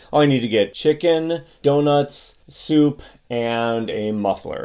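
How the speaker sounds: CHICKEN, DONUTS, SOUP held up, MUFFLER falling; list intonation. Shown as a demonstration. Demonstrating list prosody, pitch dropping only on the final MUFFLER. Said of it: "the list goes down at the end".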